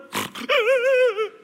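high teary laugh; choked high laugh; tearful laugh. Real, not acted. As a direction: laugh through tears.

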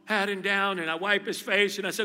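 ordinary delivery

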